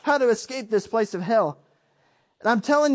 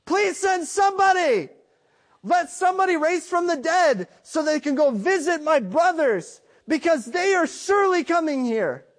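higher, cried-out plea from torment; desperate pleading cry; 6 semitones higher than usual. Retold or imitated. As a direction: beg as if burning in torment. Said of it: "his biggest request to God, to Abraham was".

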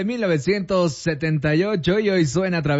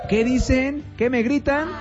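retold calling out; 7 semitones higher than usual. Host voices singer asking the cameraman, mock calling out. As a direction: imitate a puzzled singer calling out questions. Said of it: "le gritó al camarógrafo".

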